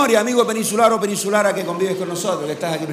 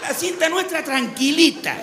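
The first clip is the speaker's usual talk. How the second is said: laid-back islander; 10 semitones higher, 4 dB louder than usual. Retold or imitated. Comedian voices a relaxed islander telling a tourist not to hurry. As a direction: tell him to go for coffee, no rush.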